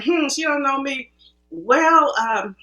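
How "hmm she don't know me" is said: higher-pitched, cocky self-quote; pompous dismissal. Retold or imitated. Acting student privately doubting her instructor, pompous. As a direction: scoff lightly, sure of yourself. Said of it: "I remember sitting there pompous".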